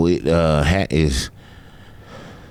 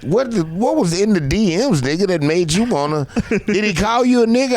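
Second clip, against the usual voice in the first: higher, pushy questioning in disbelief; incredulous friend; 9 semitones higher than usual. Retold or imitated. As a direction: press him like you can't believe it. Friend grilling him in disbelief.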